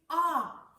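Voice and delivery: loud open ah; loud ah sound. Shown as a demonstration. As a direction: belt the ah sound out. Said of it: "put our big hands out and say".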